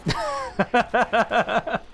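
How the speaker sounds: high, rapid chuckling; real amused laugh. Real, not acted. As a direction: break into a run of high giggles. Laughs at his Scottish colleague story.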